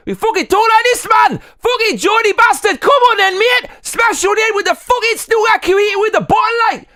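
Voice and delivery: screaming Geordie, very high and loud; shrieking Geordie lad picking a fight; Geordie shout. Pretend. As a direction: rage like a drunk Geordie football fan. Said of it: "do a few impressions".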